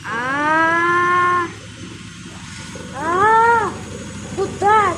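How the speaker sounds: startled, rising alarm; alarmed surprise. Real, not acted. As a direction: caught off guard, calling out. Item flying off in the machine.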